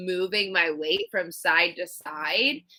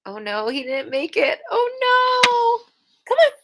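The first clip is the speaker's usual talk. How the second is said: high, loud cry of dismay; dismayed wail; 5 dB louder than usual. Real, not acted. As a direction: wail it out as the loss lands. Dismayed reaction to a loss.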